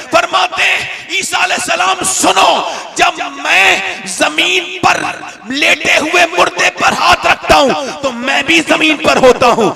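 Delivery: full-force shouting sermon, very high; shouted preaching. Acted. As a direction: roar the climax at the crowd. Sermon climax, shouted to crowd. (पूरी ताक़त से चिल्लाकर तक़रीर)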